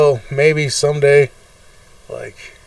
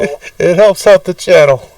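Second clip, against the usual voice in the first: louder, higher fake sobbing plea; fake crying plea; 3 semitones higher, 9 dB louder than usual. Begging viewers to subscribe through fake tears, louder. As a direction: beg through pretend tears. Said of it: "I'm crying".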